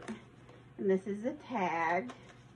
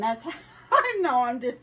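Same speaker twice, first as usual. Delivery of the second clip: real laugh breaking through, higher and louder; 4 semitones higher, 7 dB louder, faster than usual. Real, not acted. Crafter owning up to an odd envelope habit, laughing. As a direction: burst into a self-amused laugh mid-sentence, quick and high.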